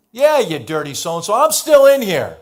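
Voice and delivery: a prisoner jeering at the king; mock angry taunt. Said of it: "kinda messing with him".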